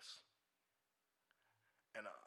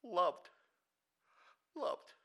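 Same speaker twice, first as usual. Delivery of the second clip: wounded echo; 7 semitones higher, 5 dB louder than usual. Retold or imitated. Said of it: "he just picked out".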